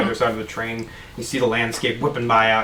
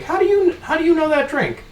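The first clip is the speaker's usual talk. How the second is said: bartender voice. Acted. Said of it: "kinda looks at you, like".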